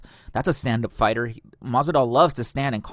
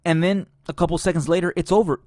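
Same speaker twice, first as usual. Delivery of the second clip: excited; 6 semitones higher, 5 dB louder than usual. Real, not acted. Dramatic finish of the fight.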